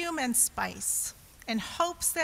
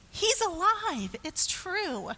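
excited; 6 semitones higher than usual. Acted. Mary announces resurrection.

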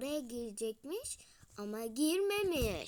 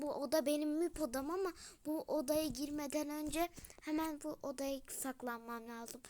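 ordinary talk, nothing marked

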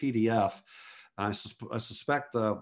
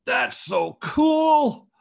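much higher and louder, very slow; amazed delight; 8 dB louder, much slower than usual. Retold or imitated. Stranger won over by the swipes, drawn-out amazement. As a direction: stretch out the wonder like a won-over kid.

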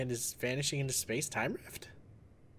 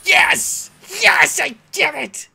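much higher, louder triumphant yell; victory shout; 15 dB louder, much slower than usual. Real, not acted. Gamer beating the boss, triumphant shout. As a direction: scream with relief at the win.

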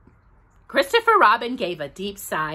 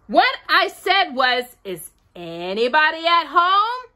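calling very loudly; 7 semitones higher, 4 dB louder than usual. A narrator's reading. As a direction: say it again, insistently, so they hear. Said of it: "Very loudly".

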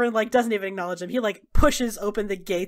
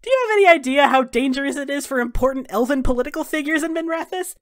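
high, loud, hurried reprimand; Dorian scolding in alarm; 6 semitones higher, 7 dB louder, faster than usual. Acted. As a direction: scold them urgently, pitched up.

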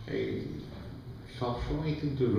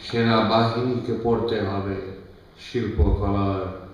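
measured declamation with long pauses between phrases; poem recitation; 4 dB louder than usual. Acted. Poet reads poem to the head of state, declaimed recitation. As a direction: recite it line by line, weighting each listed word.

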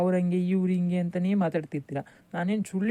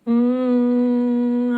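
whiny groan; 2 semitones higher, 5 dB louder, much slower than usual. Acted. Son whines at mother.

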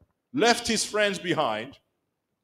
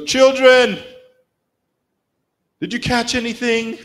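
higher, louder mocking call across the water; sarcastic Jesus; 8 semitones higher, 9 dB louder than usual. Preacher voicing Jesus teasing fishermen, sarcastic higher call. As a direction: tease the fishermen with a sarcastic shout. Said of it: "Jesus is sarcastic".

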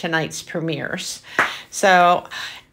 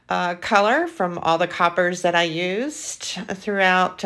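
normal talking voice

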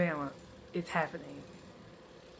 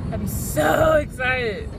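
slow, loud drawn-out shout; excited shout; 8 dB louder, much slower than usual. Real, not acted. In the car, loudly telling viewers her excitement. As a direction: belt the excitement.

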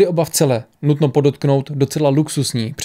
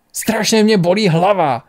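pained, higher-pitched cry; 6 semitones higher than usual. A narrator's reading. Questioned Mnemosiné collapses with a headache, crying out. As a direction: cry out in pain, pitch raised. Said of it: "křikla Mnemosiné".

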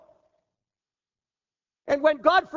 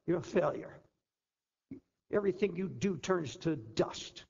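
dropped low and much softer, a hushed accusing voice; low hushed accusation; 9 semitones lower, 10 dB quieter than usual. Acted. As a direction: whisper the verdict down low like an inner voice. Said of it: "you'll hear all kinds of voices".

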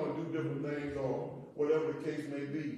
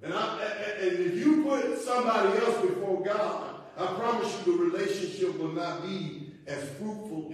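loud, raised preaching voice; forceful preaching; 6 semitones higher, 9 dB louder than usual. Real, not acted. Pastor teaching the congregation to put God first, forceful preaching. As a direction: drive the point home like a preacher.

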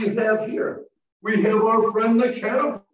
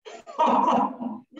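laugh. Real, not acted. Laughs at catching butterflies.

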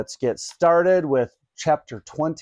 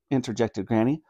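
ordinary delivery, nothing marked

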